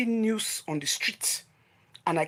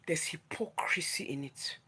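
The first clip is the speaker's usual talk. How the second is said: slow whispered reveal; hushed slow whisper; dramatic whisper; 3 semitones lower, 8 dB quieter, slower than usual. Real, not acted. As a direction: breathe it out like a secret. Dramatic list of scandal items.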